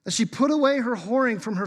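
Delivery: loud, high, pleading call to the mother; God as an anguished husband, pleading loudly; pleading cry. Acted. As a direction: cry out begging the kids to plead with her. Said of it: "And he says this, he goes".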